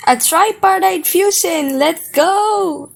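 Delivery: high, excited cheer; victorious fan yell; triumphant hype. Real, not acted. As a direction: cheer it high, thrilled to be right. Celebrating that their theory came true.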